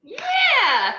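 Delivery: very high, loud stretched squeal; drunk squeal. Acted. Drunk Harley squealing yes to the creeper's offer.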